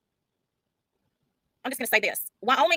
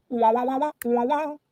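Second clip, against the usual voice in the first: mock wah crying.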